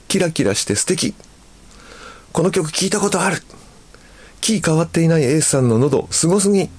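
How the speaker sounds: high-pitched delighted admiration; excited fan gushing. Retold or imitated. Listener hearing A's old album, gushing.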